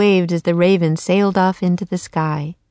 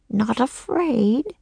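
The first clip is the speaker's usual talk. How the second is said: put-on slow, higher, weak old woman's question; feeble voice; 5 semitones higher, 4 dB quieter, slower than usual. A narrator's reading. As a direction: ask feebly, drawing it out. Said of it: "said a feeble voice".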